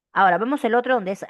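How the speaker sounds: ordinary talk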